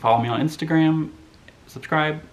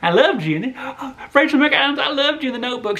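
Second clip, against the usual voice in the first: much higher, gushing fangirl voice; mock the overeager fan who gets the actress wrong; 12 semitones higher than usual. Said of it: "they don't wanna hear, like".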